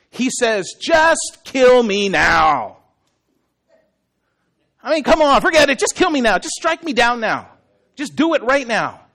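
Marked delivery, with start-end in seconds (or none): 0.8-2.8 loud, drawn-out exasperation; ticked off. Acted. Jonah so upset at God he asks to die, ticked off. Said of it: "this is how ticked off Jonah is". 4.8-9.0 high, exasperated outburst; ticked off. Acted. Jonah telling God to strike him down, ticked off. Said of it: "this is how ticked off Jonah is".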